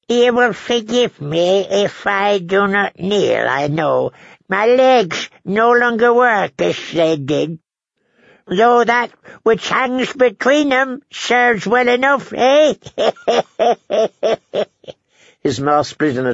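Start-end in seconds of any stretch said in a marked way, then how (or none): 0.1-12.7 put-on high, slow old-man voice; toothless old lord. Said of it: "it was the old man who spoke". 12.8-14.6 put-on high, stuttering old-man cackle; old man's cackle. Old lord laughs at his joke.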